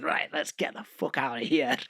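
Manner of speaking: imitated strong Scottish accent. Manager walking out of a label meeting, very Scottish accent. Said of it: "very Scottish, uh, manager stood up".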